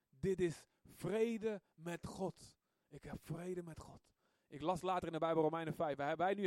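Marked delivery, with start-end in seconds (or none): 0.1-2.6 very slow, leaning on vrede; slow savouring. Retold or imitated. Savouring peace with God. 2.9-4.0 soft repeat. Retold or imitated. Repeating softly.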